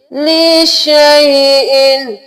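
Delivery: लिशैइन drawn out slowly; slow careful लिशैइन; correct reading. Shown as a demonstration. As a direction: stretch लिशैइन. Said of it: "confuse होते हैं".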